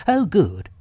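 high, relieved character voice; lifted storybook animal, pleased; Rabbit. A narrator's reading. Rabbit reassured about his door, high relieved voice.

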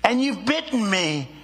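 a lightly voiced stricken woman crying out. A narrator's reading. Bitten woman cries out to the snake, lightly voiced in shock. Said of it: "cried the woman".

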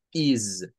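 "is" said with a buzzing z, low and slow; English is. Shown as a demonstration. Teacher shows students the z sound in "is". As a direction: sound "is" as iz with a voiced z. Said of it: "el sonido es este".

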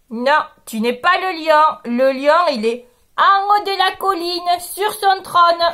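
the sheep sets him straight; firm sheep. Acted. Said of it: "Le mouton lui répond". (le mouton refuse fermement)

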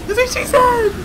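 high, loud punchline; frantic crowing yell; shouted joke. Real, not acted. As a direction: yell it in excitement. Shouted joke mid-game.

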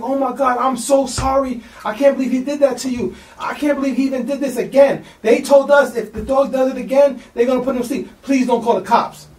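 mom pleading. Retold or imitated. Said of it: "she's like".

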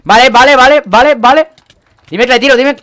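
very high, very loud urgent calls; excited shouting. Real, not acted. Gamer yelling to teammate mid-fight, excited shout. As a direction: yell it in a burst of urgency.

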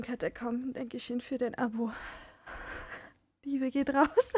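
ordinary delivery

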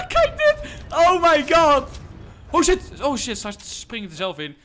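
very high, loud excited yells; real overexcited gamer shouting; hyped screaming. Real, not acted. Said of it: "ik schreeuw, ik ben hyped".

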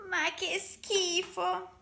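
high, drawn-out cry of disgust; disgusted exclamation. A narrator's reading. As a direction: exclaim it slowly, pitched right up. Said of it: "esclamò la piccola talpa". (esclamazione schifata)

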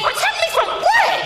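high, sharp indignant cry; demanding shout. Acted. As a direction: throw the question back at him. Wife demands answers.

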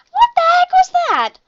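shrill, loud disbelieving cry; shocked outburst. Real, not acted. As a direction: yell at the screen in disbelief. Frustrated gamer outburst.

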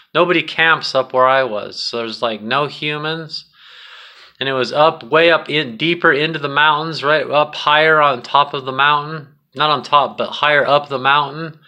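usual speaking voice

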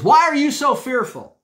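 loud, high, stern rebuke; Jesus rebuking his frightened disciples, loud and stern. Retold or imitated. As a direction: scold the disciples sharply. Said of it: "I'm convinced he said it just like that".

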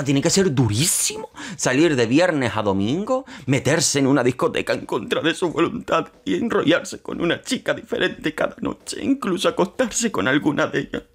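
hammed-up sarcastic lament over a poor partying son; sarcastic pity. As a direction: pour on fake sympathy with heavy irony. Mocks Álvaro's hard nights out.

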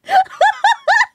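very high, loud burst of laughter; shrieking laugh. Real, not acted. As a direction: crack up at your own joke. Laughing at own confession.

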